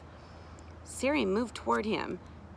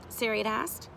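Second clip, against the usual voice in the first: ordinary delivery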